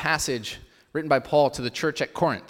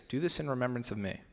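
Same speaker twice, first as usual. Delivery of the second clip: quiet repeat; 8 dB quieter than usual. Shown as a demonstration. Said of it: "Remember that phrase".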